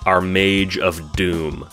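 usual speaking voice

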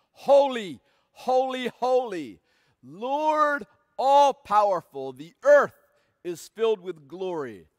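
loud, ringing proclamation of holy, holy, holy; a preacher's hammed-up angelic shout; seraphim shouting. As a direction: call it out like angels in worship. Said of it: "and they shouted".

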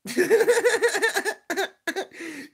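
high burst of laughter. Real, not acted. Bursts out at chat's answer.

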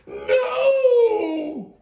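very high, loud anguished wail; horrified cry. A narrator's reading. Miranda hearing her snake goes in the bin, anguished cry. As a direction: wail it in horror.

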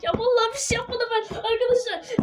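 high, whiny fake sobbing; mock crying plea. Gamer pleading with Greg not to go, hammed-up crying. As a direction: beg Greg in hammed-up tears.

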